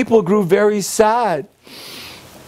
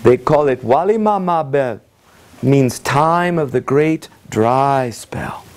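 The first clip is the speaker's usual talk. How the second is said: ordinary delivery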